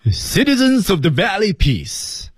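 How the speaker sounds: high, ringing ceremonial announcement; booming host presenting champions; grand announcer. Acted. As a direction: proclaim to the whole valley like a master of ceremonies. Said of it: "它是怎么说的呢".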